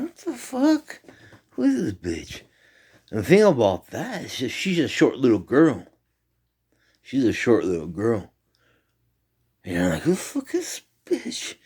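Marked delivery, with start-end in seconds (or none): 0.0-2.4 high, squeaky mock outcry; retold alarm. Retelling being choked by a girl in a dream, high mock alarm. 10.0-11.5 high, squeaky mock outcry; retold alarm. Retelling being choked by a girl in a dream, high mock alarm.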